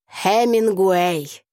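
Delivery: very slow, drawn-out announcement; proud. A narrator's reading. Classmate names his author to Tasya, proudly. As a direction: stretch the name out with pride. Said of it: "гордо отозвался тот".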